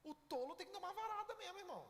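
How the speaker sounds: high, loud, fast preaching punch; fiery pulpit exclamation; preaching burst. Real, not acted. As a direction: hit it hard for the congregation. Preacher hammering home the rod.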